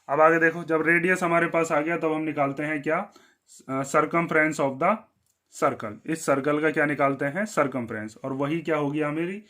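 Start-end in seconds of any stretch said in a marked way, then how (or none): 3.7-5.9 slow dictation. Shown as a demonstration. Teacher dictating while writing.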